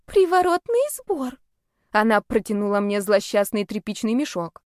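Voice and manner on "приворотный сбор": high, slow small voice; sheepish girl. A narrator's reading. Girl admits what smells, sheepish and high.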